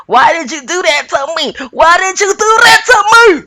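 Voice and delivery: high, loud repeated accusing shout; imitating yelling. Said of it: "she's yelling questions".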